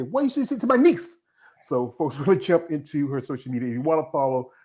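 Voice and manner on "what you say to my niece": a hint of a protective uncle, pitched higher; mock-menacing question at the door; mock threat. Host imitating himself confronting creeps, mock threat.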